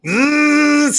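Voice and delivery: drawn-out mechanical zzz sound effect; imitated camera whir. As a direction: buzz like a loud film camera motor. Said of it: "super loud".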